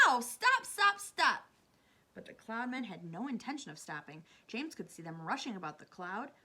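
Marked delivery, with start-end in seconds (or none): 0.1-1.4 high, loud pained pleading; cried out. A narrator's reading. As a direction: yelp and beg them to stop. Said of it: "I cried".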